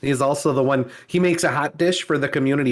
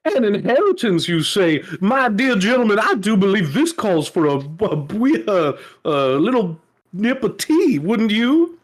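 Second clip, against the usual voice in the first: higher, syrupy genteel hostess voice; mock charming hostess; 4 semitones higher than usual. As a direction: sweet-talk the guest into tea. Voicing the charming killer hostess.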